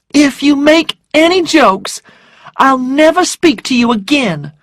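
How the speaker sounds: high, loud, upset threat; frightened indignation, pitched high; voice shaking. A narrator's reading. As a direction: warn him in a high, agitated voice. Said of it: "her voice shaking".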